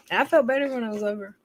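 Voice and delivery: normal talking voice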